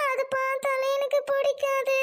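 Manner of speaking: usual speaking voice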